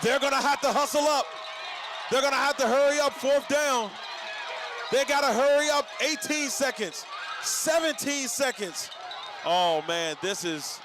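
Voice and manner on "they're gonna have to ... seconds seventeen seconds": rising, urgent play-by-play call, racing the clock; live play-by-play. Real, not acted. Announcer calling the final seconds to the crowd, urgent and rising.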